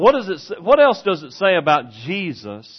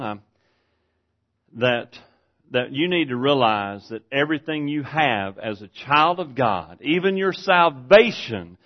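usual speaking voice

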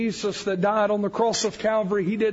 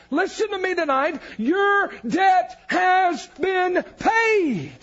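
preaching at full force; 7 semitones higher than usual. Acted. Preacher to congregation, rising to a full-force climax. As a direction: drive it home to the congregation.

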